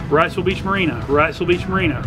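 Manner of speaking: ordinary delivery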